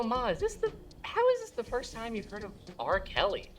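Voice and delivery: high, incredulous whine; exasperated son. Retold or imitated. Son exasperated with his mom.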